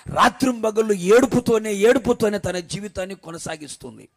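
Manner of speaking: loud, slow, building sermon; slow loud preacher's lament; preaching at full force. Acted. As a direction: hammer home her grief from the pulpit. Preaches the wife's suffering.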